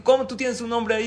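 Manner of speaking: high, loud accusing question; jealous husband. Acted. Husband accuses his wife of hiding a man, high and loud. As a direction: throw it back at her.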